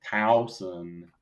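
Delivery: "Towson" slowly practised as TAU-sun; first syllable of "Towson" rhymes with cow; corrected pronunciation. Shown as a demonstration. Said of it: "have to change that in my head".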